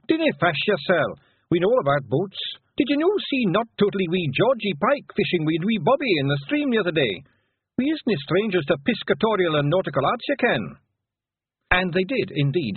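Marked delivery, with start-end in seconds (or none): 0.1-10.8 higher Scots pixie voice; broad Scottish accent. Acted. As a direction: Rob Anybody's Scottish brogue. Rob's Scots speech continues.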